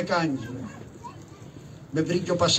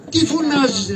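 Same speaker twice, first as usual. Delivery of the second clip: a sharp, much higher snap; irritated scolding; 6 semitones higher than usual. Acted. Karagiozis scolds the crier for shouting, sharply. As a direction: tell him off for yelling.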